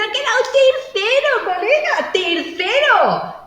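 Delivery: put-on high, loud kid yelling with joy; excited kid. Host voices the third-place kid cheering, high excited shout. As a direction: shout it like a thrilled child on the podium.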